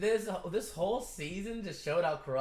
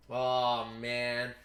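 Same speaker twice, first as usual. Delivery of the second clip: ordinary delivery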